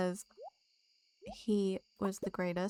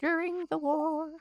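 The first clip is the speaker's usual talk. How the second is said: mock old veteran; 7 semitones higher, 4 dB louder than usual. A streamer voices an old character's war memories, mock veteran. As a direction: reminisce like an old soldier.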